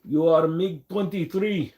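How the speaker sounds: put-on high, slow, failed Russian accent; bad Russian accent. Streamer naming someone a MiG, badly faked Russian accent. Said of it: "I really can't do a Russian accent today".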